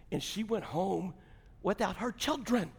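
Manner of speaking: ordinary talk, nothing marked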